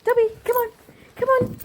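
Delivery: up high, bright coaxing; high-pitched, excited call. Shown as a demonstration. Owner coaxing Dobby to follow, high excited call. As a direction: lure the dog with a squeaky voice. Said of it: "you gotta say it in a really high-pitched, exciting voice".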